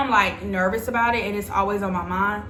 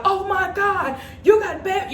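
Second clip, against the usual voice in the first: high, loud mock freak-out; panicking mom; 10 semitones higher, 3 dB louder than usual. Imagining mom's reaction to bed bugs, high panicked mock cry. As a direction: imitate a mom in alarm, pitch way up. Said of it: "My mom is gonna be like".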